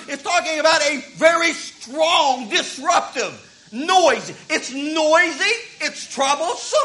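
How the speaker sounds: ordinary talk, nothing marked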